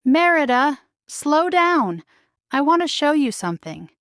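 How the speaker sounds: a mother calling after her galloping daughter. A narrator's reading. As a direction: call to a rider up front. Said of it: "Eleanor called out to her daughter".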